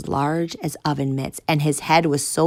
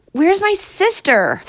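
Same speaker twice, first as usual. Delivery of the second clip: high loud desperate cry; desperate demand; 7 dB louder, slower than usual. Acted. Boy pleading with guard for his sister, loud. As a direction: demand an answer, voice cracking high.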